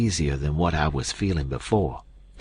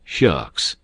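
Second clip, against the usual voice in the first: very sarcastic; 3 semitones lower than usual. A narrator's reading. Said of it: "very sarcastic".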